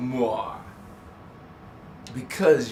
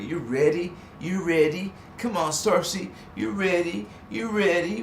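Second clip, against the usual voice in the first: rising higher with each repeat; building hype; 5 semitones higher than usual. Real, not acted. Pumping up viewers before the session, building. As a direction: pump them up, climbing.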